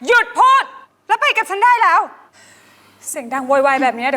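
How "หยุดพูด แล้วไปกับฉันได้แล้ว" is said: higher and louder, cutting her off; sharp order. Acted. Mistress orders the ranting drunk to come upstairs, sharply. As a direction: snap at her to come along now.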